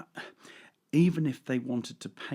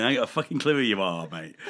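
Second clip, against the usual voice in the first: fast, louder blurted confession; inner thought; 5 dB louder, faster than usual. Retold or imitated. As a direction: rattle off the unspoken thought. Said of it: "In my head, I'm going".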